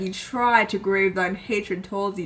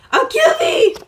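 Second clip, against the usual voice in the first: high, loud cry of rage; furious threat; 6 dB louder than usual. Acted. Rival threatens to kill in a quarrel, furious shriek. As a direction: shriek the threat at a pitch far above normal.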